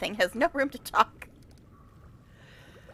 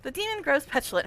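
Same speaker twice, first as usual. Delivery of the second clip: usual speaking voice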